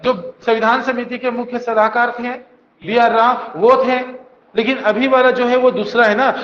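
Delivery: usual speaking voice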